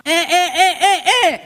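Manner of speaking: high mock toddler whimpers; mock toddler fussing at everything that happens. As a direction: fuss like a small child about to cry. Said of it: "we're like".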